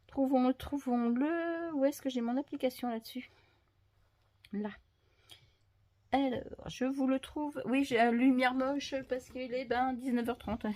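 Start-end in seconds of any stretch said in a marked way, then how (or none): none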